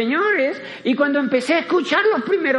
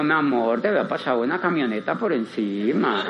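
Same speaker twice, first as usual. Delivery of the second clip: mock tender reply; 8 semitones lower than usual. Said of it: "Y yo le digo".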